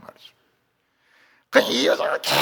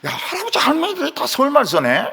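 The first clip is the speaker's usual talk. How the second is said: high amazed exclamation; surprised wonder; 9 semitones higher than usual. Retold or imitated. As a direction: gasp at the discovery, pitched up. Marveling at Seoul speakers.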